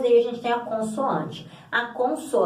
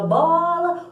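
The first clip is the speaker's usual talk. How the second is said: "bola" with a long, raised BO; sílaba tônica forte; 7 semitones higher, 4 dB louder than usual. Shown as a demonstration. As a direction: stretch and lift the first syllable of "bola". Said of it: "o bo é mais forte". ("bola" esticando o "bo")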